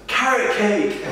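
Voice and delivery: put-on high-pitched voice of a girl in the crowd; mock female fan's high shout; imitated girl's call. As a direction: call it out high, on the edge of laughter. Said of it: "until one girl says".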